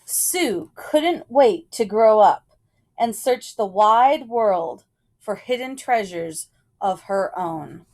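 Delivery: ordinary delivery, nothing marked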